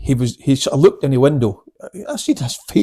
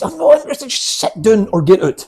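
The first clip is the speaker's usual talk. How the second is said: retold exasperated telling-off, higher and louder; impatient order, pitched up and forceful; losing patience; 8 semitones higher, 6 dB louder than usual. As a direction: snap at a distracting onlooker, retelling it. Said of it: "I lost patience with a guy".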